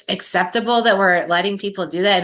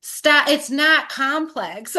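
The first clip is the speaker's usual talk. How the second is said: high, slow, emphatic insistence; exasperated yelling; 6 semitones higher, much slower than usual. Real, not acted. Speaker tells people to stop overcomplicating, real exasperated yelling. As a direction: push each word out in frustration. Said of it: "I'm yelling".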